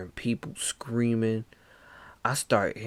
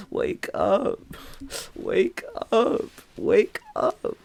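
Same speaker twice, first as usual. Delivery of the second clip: higher, louder cries of wake up; desperate yell; 12 semitones higher, 4 dB louder, slower than usual. Retold or imitated. Trapped dreamer yelling at himself to wake up, high desperate cries. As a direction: shout yourself awake in panic.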